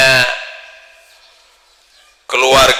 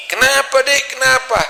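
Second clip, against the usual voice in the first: much higher, anxious asking; alarmed husband; 14 semitones higher than usual. Retold or imitated. Husband rushes to crying wife, high alarmed. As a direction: ask what's wrong in alarm.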